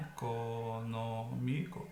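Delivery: ECONÔMICO drawn out slowly and low; syllable by syllable. Shown as a demonstration. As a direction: stretch each syllable of econômico. Teacher separates syllables of example. (econômico bem devagar, separando as sílabas)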